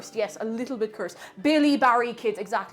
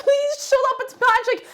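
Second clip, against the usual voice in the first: loud, much higher begging; exasperated plea; 9 dB louder than usual. Retold or imitated. Yelling at engineering students during the film, loud high plea. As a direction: plead with them to stop.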